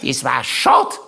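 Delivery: deadpan regret in a high aunt voice; Aunt Mari's voice. Retold or imitated. Aunt Mari telling everyone, high and dry. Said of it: "sie es aber allen noch einmal gesagt hat".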